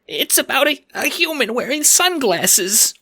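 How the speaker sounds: frightened little squeak. Acted. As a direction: squeak it out in panic. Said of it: "you squeak".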